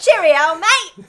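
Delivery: high, shouted British sign-off; mock British accent. Podcaster reprising her British bit for co-host, loud mock British. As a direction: belt out a hammed-up British farewell, laughing.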